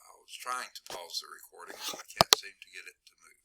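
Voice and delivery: quick hushed whisper aside. Real, not acted. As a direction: whisper fast, off the record. Aside while pausing the recording.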